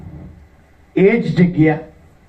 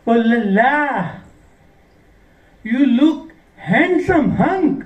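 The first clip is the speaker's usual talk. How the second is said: high, drawn-out flirty compliment; put-on high voice, playfully flattering; admiring woman; 6 semitones higher, much slower than usual. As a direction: coo it like an impressed admirer. Acting the woman admiring him.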